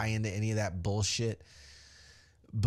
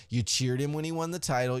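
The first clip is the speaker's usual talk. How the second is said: usual speaking voice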